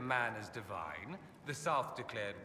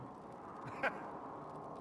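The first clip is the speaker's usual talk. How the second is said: breathy amused laugh, high and soft; airy laugh; 10 semitones higher, 5 dB quieter than usual. Acted. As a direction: laugh lightly at the naive question. Laughs at the question.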